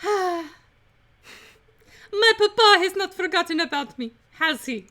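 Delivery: high-pitched, bad French lady; attempted French accent. Prisoner asking after her papa, high mock-French accent. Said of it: "Not good with the French".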